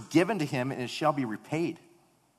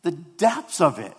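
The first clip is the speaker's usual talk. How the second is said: loud, high, impassioned sermon voice; fervent preaching; 8 semitones higher, 10 dB louder than usual. Acted. Pastor preaching to his church, loud and fervent. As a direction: preach it out to the congregation.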